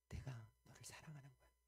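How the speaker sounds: whispered 내가 너를 사랑하는 거야. Acted. Preacher voicing God whispering love to her, hushed whisper. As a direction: breathe it as a secret of love. God's tender words retold.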